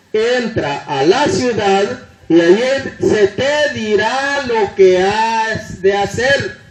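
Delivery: slow, measured delivery of an instruction; emphatic quoted command. Retold or imitated. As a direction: draw the words out deliberately. Preacher quotes Jesus' command for emphasis.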